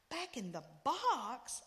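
slow, loud, drawn-out wail of dismay. A narrator's reading. Child wails at mother about the extra Jesus, slow and loud. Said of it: "I wailed".

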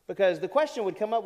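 usual speaking voice

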